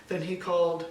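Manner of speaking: ordinary delivery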